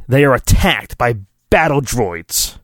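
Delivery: ordinary talk, nothing marked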